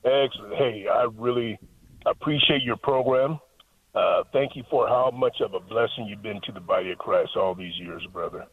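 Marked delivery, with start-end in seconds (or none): none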